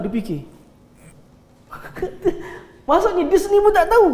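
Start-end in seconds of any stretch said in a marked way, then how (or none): 2.9-4.1 higher and louder, laughing disbelief; amused exclaim. Real, not acted. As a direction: marvel that even he doesn't know.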